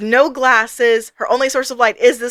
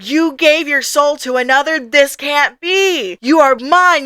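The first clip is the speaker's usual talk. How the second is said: higher, building mock-furious outburst; enraged god; 4 semitones higher, 3 dB louder than usual. Angry god rages at Ray for stabbing it, hammed-up fury. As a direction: act out an outraged god, pushing up and louder. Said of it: "Our god is mad. It's angry. It's pissed".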